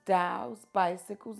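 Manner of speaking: usual speaking voice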